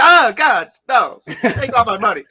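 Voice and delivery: high, loud, laughing disgust; disgusted Brit. Pretend. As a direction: squeal it in mock horror.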